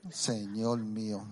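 ordinary talk

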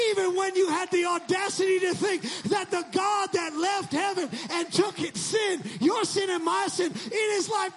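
loud, high shouted preaching climax; preaching at full force. Real, not acted. Preacher rousing congregation, full shout. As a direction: shout it to the rafters.

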